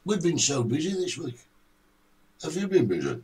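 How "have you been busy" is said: ordinary talk, nothing marked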